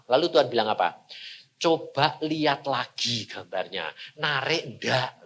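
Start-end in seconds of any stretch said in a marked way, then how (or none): 1.5-5.2 soft, lower, unhurried question; quiet voice of God. Acted. God softly asks the preacher if the picture still attracts him, hushed. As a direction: gently nudge him to look again.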